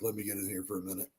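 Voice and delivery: imitating Kirk Cousins sneaking in; mock sneaky aside. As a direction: slip it in quietly and fast. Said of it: "He's like".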